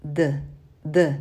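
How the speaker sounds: tongue flat against palate and teeth for d; Spanish d. Shown as a demonstration. Teacher demonstrating Spanish d to learners. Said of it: "So it sounds".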